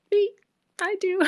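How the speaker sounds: high, bright eager yelp; eager volunteering. Retold or imitated. Student eagerly answers classmate's lunch invitation, high and excited. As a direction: jump at the offer to eat together.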